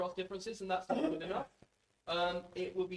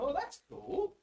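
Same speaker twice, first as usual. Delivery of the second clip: much higher, delighted exclamation; retold delight; 11 semitones higher, slower than usual. Presenter retelling first seeing the report, excited. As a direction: pitch it up like a pleased discovery. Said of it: "I went".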